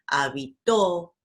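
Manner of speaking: habitó with a strong TÓ; final-syllable stress. Shown as a demonstration. Teacher giving examples of agudas, final stress on habitó. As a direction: lean hard on the end of habitó. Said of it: "tienen la última sílaba".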